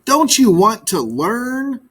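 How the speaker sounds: high, slow exasperated question. Acted. Magpie scolding the birds who won't listen, exasperated. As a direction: plead with a tired edge. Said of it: "cried the frustrated magpie".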